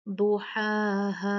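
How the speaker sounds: slow "दुहाहा" with long held vowels; "दुहाहा" chanted, long ending; two-count madd. Shown as a demonstration. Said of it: "दो count मद करके".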